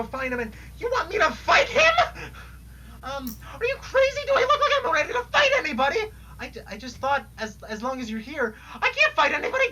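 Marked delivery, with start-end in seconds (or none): 0.8-6.0 tearful panic. Acted. Jade, asked to fight the destroyer, panics in tears. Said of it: "this fucking crying's making me lightheaded". 8.7-9.7 crying laugh. Acted. Jade refuses to fight, laughing through tears. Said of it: "this fucking crying's making me lightheaded".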